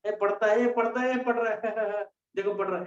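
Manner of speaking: quick, laughing taunt; acted insult, giggling through it; mocking jeer. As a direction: tease a studious classmate with a snicker. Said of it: "सब insult करेंगे".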